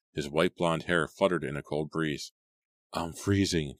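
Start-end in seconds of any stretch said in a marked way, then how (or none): none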